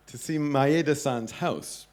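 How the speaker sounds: normal talking voice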